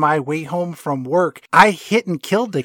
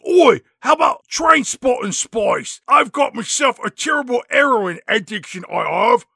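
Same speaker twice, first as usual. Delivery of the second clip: mock Cockney; 6 semitones higher than usual. [name] pitching a new persona, mock Cockney.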